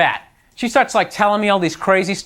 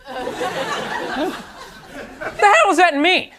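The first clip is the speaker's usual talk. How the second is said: baffled retort; 8 semitones higher than usual. Retold or imitated. Comic retelling his reaction to his date's face, incredulous and higher. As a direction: blurt it out, totally confused. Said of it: "I was like".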